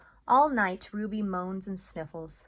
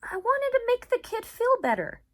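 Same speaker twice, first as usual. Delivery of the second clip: high, louder sheepish dog voice; Bob apologising; 10 dB louder than usual. Acted. Bob defending himself to Ivan, sheepish. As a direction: excuse yourself sheepishly. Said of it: "Bob lowers his head".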